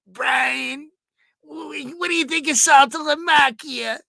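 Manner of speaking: high, loud caller voice; Ralph impression. Host imitating Ralph calling into the show, high and excited. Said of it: "Ralph would be calling out".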